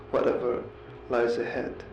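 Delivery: ordinary talk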